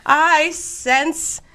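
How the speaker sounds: slow, high mock trance building to a loud wail; hammed-up medium. Wife channeling Houdini's mother, building to a high, loud theatrical call. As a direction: ham up the spirit arriving, with a gasp. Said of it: "she does the whole thing".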